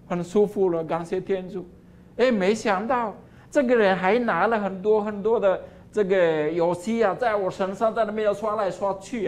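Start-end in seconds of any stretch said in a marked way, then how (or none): none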